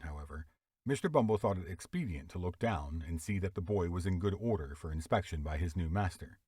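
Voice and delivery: ordinary delivery, nothing marked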